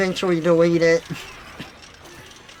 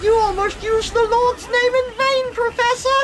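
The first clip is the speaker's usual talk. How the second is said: put-on much higher, fast know-it-all kid voice; obnoxious Luke. Luke lecturing the professor, high know-it-all whine. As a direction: rattle it off like an insufferable child. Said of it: "they make Luke just the most obnoxious thing".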